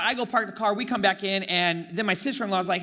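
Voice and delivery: ordinary delivery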